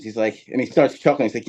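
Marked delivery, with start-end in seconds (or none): none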